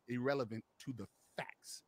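ordinary talk

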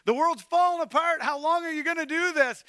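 loud, high, pleading cry to God; a loud, high lament at heaven; anguished cry. Acted. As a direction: cry out in desperate frustration. Acting out prophet's lament.

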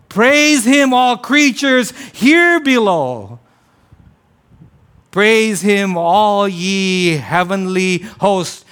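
high, loud, drawn-out proclamation; loud praise. Acted. Healed man worshiping God, loud praise. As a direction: belt out each line of the hymn as speech. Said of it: "loudly started worshiping God".

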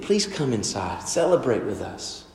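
a father quietly begging his son; hushed coaxing invitation; soft pleading. Retold or imitated.

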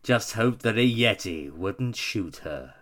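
loud, slow dramatic reading; dramatic recital. Acted. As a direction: declaim it slowly and loudly. Recites the third verse.

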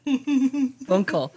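high, drawn-out German "Dunkel"; mock German accent. Shown as a demonstration. Copying co-host's correction, playful German accent. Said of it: "say this with a German accent".

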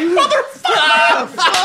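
high, drawn-out mock-stunned curse; astonished outburst. Comic reacting to the chief's lion-killing story, in an astonished high outburst. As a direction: blurt it long and high, half laughing.

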